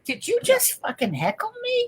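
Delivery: high, soft, incredulous jab; a lightly voiced comic retort; Pryor snapping at heckler. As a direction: challenge the heckler, half amused. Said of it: "still had it in his brain".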